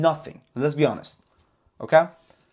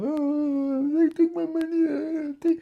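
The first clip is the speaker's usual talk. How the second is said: whiny complainer; 12 semitones higher than usual. Retold or imitated. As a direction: mimic a trader crying about hedge funds. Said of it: "cry and cry and blame".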